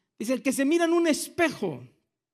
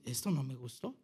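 mock dismay; 12 semitones lower, 13 dB quieter than usual. Voicing himself reading the Bible.

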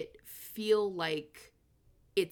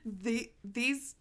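laughing; 6 semitones higher than usual. Real, not acted. Still laughing after her joke.

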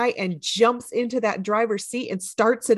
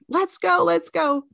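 mild mock hurry; 8 semitones higher than usual. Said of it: "when Thelma is telling her".

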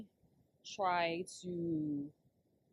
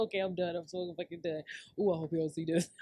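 ordinary delivery

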